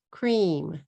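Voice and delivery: "Cream" in one long falling beat; one-syllable English. Shown as a demonstration. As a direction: say "Cream" with the cr joined and a closed m. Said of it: "One syllable".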